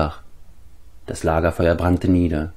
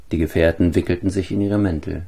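ordinary delivery

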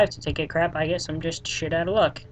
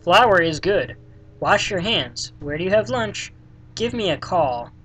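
ordinary delivery

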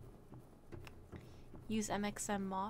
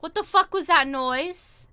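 startled yell; 4 semitones higher, 13 dB louder than usual. Real, not acted. Startled by noise.

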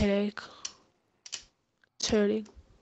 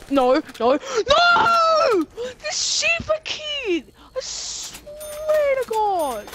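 high, loud, drawn-out furious yell; real fury as he keeps getting killed; enraged shout; 10 semitones higher, 3 dB louder, slower than usual. Real, not acted. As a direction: scream it at the screen, seething. Killed again by the same player.

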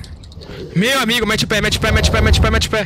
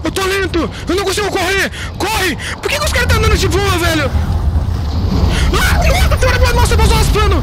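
being chased; 6 semitones higher than usual. Real, not acted. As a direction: yell in panic while fleeing. Fleeing crabs, shouting.

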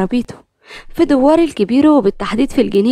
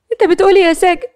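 much higher, louder disbelief; shock and alarm; 8 semitones higher, 5 dB louder than usual. A narrator's reading. Nour hearing Salim will remarry, shocked. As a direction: gasp it out in shock. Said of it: "نور بصدمة وفزع قالت".

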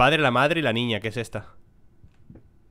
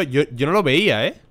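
ordinary delivery

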